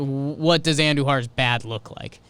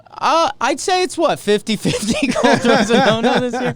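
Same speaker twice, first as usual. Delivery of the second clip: laughing; 10 semitones higher, 6 dB louder than usual. Real, not acted. Laughing at caller's no-hitter odds.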